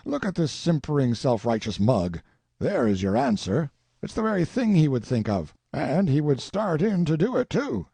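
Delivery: put-on old colonel sizing up a face, mildly excited; lightly voiced old colonel. A narrator's reading. Colonel to Tracy about a portrait, lightly voiced old man. Sellers speaks as a character.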